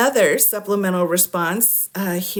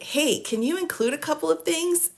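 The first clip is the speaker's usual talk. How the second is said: much higher, light polite ask; mock sweet request; 9 semitones higher than usual. Defense counsel asking the government, mock sweet. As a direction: sweeten it like a favour. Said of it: "the other side said".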